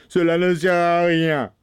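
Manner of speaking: angry shout. A narrator's reading. Bluebeard rejecting his wife's tears, shouting angrily. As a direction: dismiss the plea forcefully. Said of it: "se mit à crier si fort".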